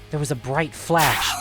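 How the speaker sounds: usual speaking voice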